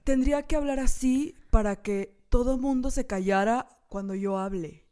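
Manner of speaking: slow lazy socialite delivery. Shown as a demonstration. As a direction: stretch every word slowly. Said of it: "como muy lento".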